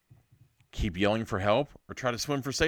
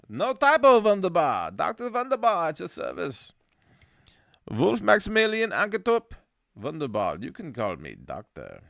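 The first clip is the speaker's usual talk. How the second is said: Doctor Wunderbar; 11 semitones higher, 7 dB louder than usual. Acted. German doctor introducing himself to listeners, mock German accent.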